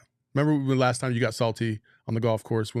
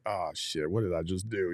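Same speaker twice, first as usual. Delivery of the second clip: quiet dismayed mutter, lower and softer; muttered to himself; 5 semitones lower, 8 dB quieter than usual. Retold or imitated. Player at the line realizing his error, quiet mutter. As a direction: mutter it under your breath, realizing the mistake. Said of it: "He was just like".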